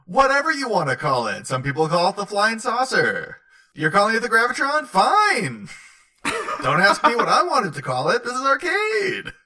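Arcade voice. Acted. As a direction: play Arcade as a smug showman. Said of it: "And Arcade sort of says".

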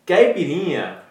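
caipirinha said the Brazilian way, high and loud: kai-pi-RI-nya; Brazilian Portuguese accent. Shown as a demonstration. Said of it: "say it in a real Brazilian way".

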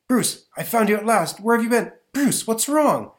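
high urgent professor voice; alarmed professor. Acted. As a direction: call out to him in worry. Bennett finds Bruce.

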